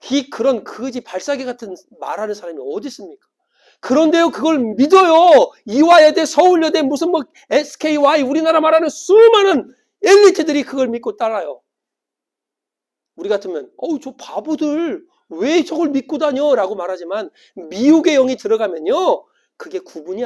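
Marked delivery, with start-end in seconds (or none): 3.8-11.6 raised pitch, loud fervent preacher; impassioned preaching. Real, not acted. Preaches at full force. 13.8-16.5 high-pitched disbelief at fools; scornful exclamation. Acted. Voices scornful onlookers.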